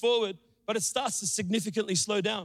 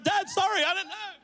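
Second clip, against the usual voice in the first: high-pitched mock-exasperated apology; acted squealed excuse; retold yelling back; 10 semitones higher than usual. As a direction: protest high, as a flustered child to dad. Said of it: "I'm yelling back at him".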